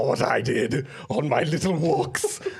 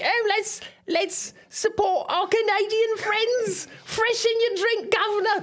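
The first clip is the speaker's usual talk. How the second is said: much higher, hammed-up Dickensian Cockney; slower than usual. Panelist imitating a politician in a Dickensian Cockney accent. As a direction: chirp it like a street urchin from Dickens, Cockney. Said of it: "In a Dickensian Cockney accent".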